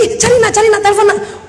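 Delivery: high, urgent mock alarm; panicked mother. Mother urging son to find his lost phone, alarmed. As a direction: urge frantically, pitch jumping up.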